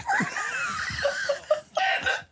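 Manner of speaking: high, wheezing real laugh; helpless laughter. Real, not acted. Comic cracking up at his friend's line, helpless laughter. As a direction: crack up completely, squealing.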